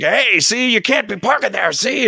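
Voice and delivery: put-on high grumpy old man; old guard scolding. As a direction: crank it like an old codger. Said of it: "this guy was, like, seventy years old".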